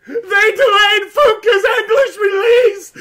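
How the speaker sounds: mock crying wail. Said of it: "And he's crying".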